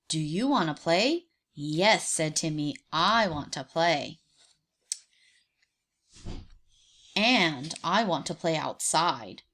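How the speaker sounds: a deep, eager little tiger; Timmy the tiger. Acted. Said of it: "And Timmy said".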